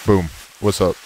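ordinary talk

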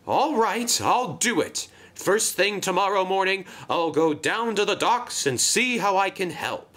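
high, determined storybook voice; upbeat Marion. Acted. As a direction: voice a willing engine agreeing to help.

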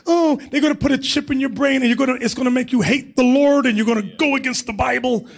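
high, rushed voice of a worried believer; hammed-up fretting, high and hurried; mock alarm. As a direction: parody a panicked worrier, pitched well up. Acting out worried believers' objection.

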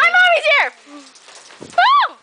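high excited squeal; excited shout. Real, not acted. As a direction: shout it out thrilled. Excited shout as mom arrives.

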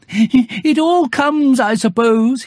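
very slow, high, rueful bear; Pooh musing mid-fall. Acted. Bear resigned as he falls, slow and high. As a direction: draw it out like a bear resigned to falling. Bear concluding while falling.

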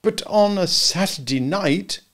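hint at it slyly; insinuating. A narrator's reading. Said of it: "Slightly insinuated".

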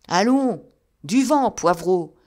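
loud, gruff shout from a window; angry growl. A narrator's reading. Old man at window chasing off a visitor, angry shout. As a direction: bark him away from the door. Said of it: "gronda une voix".

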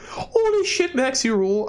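high-pitched, hyped-up cheer; excited congratulation. Acted. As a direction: squeal it high, thrilled for a friend. Reads Chloe's excited congratulation text.

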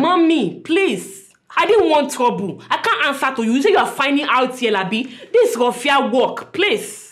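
laughing plea. Acted. Receptionist pleading with customer not to make trouble, laughing. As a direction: beg her off while half laughing.

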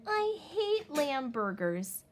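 high, slow drawn-out whine; whining. Acted. Little wolf refusing his dinner, slow high whine. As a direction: drag it out like a sulking kid. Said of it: "he whined".

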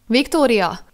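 loud sharp call of her name. Acted. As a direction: snap her back with a shout. Jack calls her out of trance.

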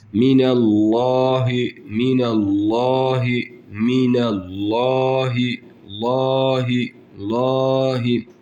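minallahi with the a after the l held one elif; stretched recitation. Shown as a demonstration. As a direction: recite minallahi, draw out the lam. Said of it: "Bir elif miktarı uzatıyoruz". (minallahi bir elif uzatarak)

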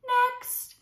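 high, loud summons; fox's yell. Acted. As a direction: call out sharply for the next victim. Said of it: "Then Foxy yelled".

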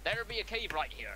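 put-on pirate accent. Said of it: "keep up with the accent".

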